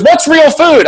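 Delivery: high, loud quiz question; quizzing nurse. Retold or imitated. Nurse quizzing patient, high loud challenge. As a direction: test him like a strict teacher. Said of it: "she started quizzing me".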